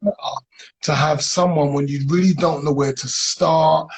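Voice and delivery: loud, upbeat live-host pitch, high and bright; loud host intro. Acted. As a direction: sell the guest to the live audience with full energy. Host introduces guest loudly.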